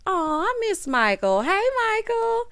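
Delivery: high, slow sing-song greeting; gushing fondness. Retold or imitated. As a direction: imitate someone cooing hello down the phone. Acts out gushing greeting on call.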